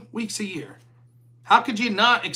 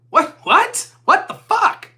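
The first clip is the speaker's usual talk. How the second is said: rising much higher and louder, outraged; pissed-off disbelief; 6 semitones higher, 6 dB louder than usual. Retold or imitated. Friend bails on the movie, so you vent in outraged disbelief. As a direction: build from a baffled what to full indignation. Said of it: "you'd be a little pissed off".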